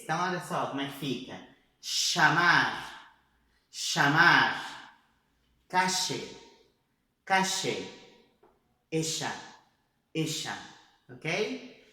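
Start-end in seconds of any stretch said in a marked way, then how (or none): 1.8-4.9 Llamar said as Chamar, ll like Portuguese ch; Chamar: open with a sh sound, Argentine style. Shown as a demonstration. Teacher models the Argentine ll for students, sh sound. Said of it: "Som da Argentina". 5.7-10.6 Calle and Ella with ll said as sh; Argentine sh sound. Shown as a demonstration. Teacher models Argentine ll, sh sound. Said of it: "som de C H do português".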